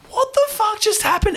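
a dad caught off guard, squeaky and high; stunned disbelief. Retold or imitated. Dad watching a classroom burst into tears, shocked high squeak. Ten kids suddenly crying.

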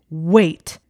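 loud snarled order; snarled command. A narrator's reading. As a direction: bark it to stop her dead. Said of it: "Anthony snarled".

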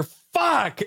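high mock curse; neighbor cursing. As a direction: yelp it up high. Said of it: "And I just hear".

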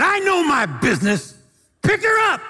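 high, loud barked order; a stern healer's command, full voice; commanding shout. Retold or imitated. Wigglesworth to the two ladies, a loud commanding shout.

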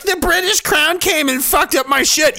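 high, whiny mock complaint; mocking whiner. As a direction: whine it like a self-pitying victim. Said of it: "You're acting like".

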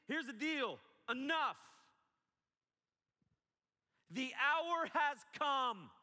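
high, loud rebuke with "The hour has come" drawn out very slowly; yelling. Shown as a demonstration. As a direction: shout the rebuke at the sleeping disciples. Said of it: "why are you yelling".

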